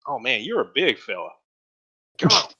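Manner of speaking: high, loud friendly welcome; dwarf welcoming a large traveller aboard; hearty dwarf. Acted. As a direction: usher a big guest in warmly. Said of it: "He's like".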